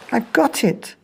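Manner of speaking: the snail suddenly has a plan; excited idea cry. Acted. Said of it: "she cried".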